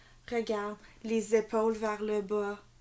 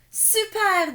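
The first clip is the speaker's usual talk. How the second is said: much higher, louder, delighted; cheerful super; 11 semitones higher, 9 dB louder than usual. A narrator's reading. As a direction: beam it out. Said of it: "dit Loulou en souriant". (ravi et joyeux, plus aigu)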